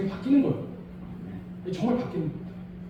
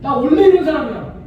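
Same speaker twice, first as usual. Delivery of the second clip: loud, mocking stubborn excuse; mock a self-excusing boast; foolish excuse; 5 semitones higher, 9 dB louder than usual. Preacher mocking a stubborn excuse, loud. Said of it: "이런 제일 바보 같은 말이에요".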